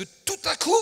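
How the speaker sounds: sudden loud, high burst; exhorting cry; preacher's shout. Real, not acted. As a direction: leap up on TOUT À COUP. Preacher builds to shouted promise.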